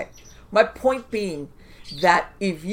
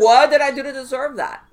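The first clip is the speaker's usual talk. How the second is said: higher and much louder, a hammed-up cry to the heavens; mock anguished wail; 8 semitones higher, 13 dB louder than usual. Mimicking people who wig out, a mock anguished wail. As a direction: overplay the self-pity, loud and high. Imitating a self-pitying victim.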